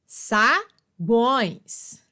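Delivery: "sagões" drawn out as sa-gões; divisão silábica. Shown as a demonstration. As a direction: pause after sa in "sagões", keep gões whole. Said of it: "na mesma sílaba". ("sagões" pausado, em sa-gões)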